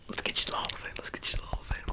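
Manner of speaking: soft whispered promise; tempting whisper. Acted.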